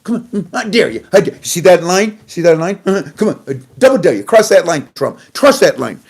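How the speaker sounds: Iran's mock schoolyard dare to Trump; taunting dare. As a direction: goad him like a kid daring a rival. Voicing Iran taunting Trump.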